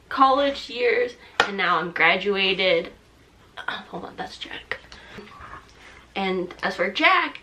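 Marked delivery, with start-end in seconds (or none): 3.6-4.8 hushed whisper, breaking off; whispered aside. Real, not acted. Quick aside about other dog.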